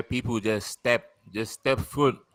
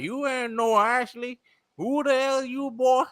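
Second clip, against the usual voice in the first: much higher, slow suspicious country voice; thick country accent; 14 semitones higher, slower than usual. Retold or imitated. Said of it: "thick ass country accent".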